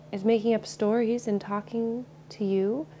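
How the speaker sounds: ordinary talk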